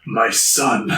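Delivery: low imitation of an old master; weird rat sensei voice. Splinter greeting Raph in the dark, rat sensei voice. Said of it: "In his weird rat sensei voice".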